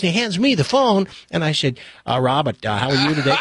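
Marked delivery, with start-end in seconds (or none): 2.1-3.4 put-on courteous senator in a Boston accent; Ted Kennedy. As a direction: greet him in a Kennedy impersonation. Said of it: "sound something like this".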